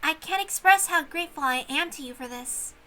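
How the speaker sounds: a lightly voiced young servant girl, grateful; gentle maid thanking her host; a hint of a shy young maid; polite young maid. Shannon's voice in the story.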